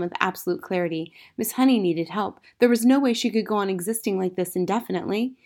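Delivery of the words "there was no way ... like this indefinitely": plain reading voice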